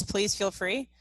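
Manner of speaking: ordinary talk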